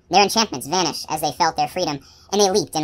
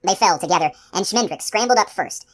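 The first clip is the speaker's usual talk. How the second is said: plain reading voice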